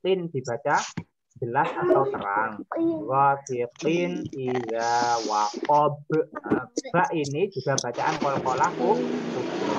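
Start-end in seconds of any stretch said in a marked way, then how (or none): 3.1-6.0 drawn-out chanted reading; slow Quran recitation. Shown as a demonstration. Teacher reciting for pupils, slow and drawn-out. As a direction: recite it slowly and clearly. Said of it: "Quran recitation demo".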